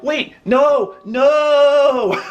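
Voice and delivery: put-on a small boy panicking as she falls; panicked kid. Boy as the fainting lady tips onto him, high panicked cry. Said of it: "And I'm like".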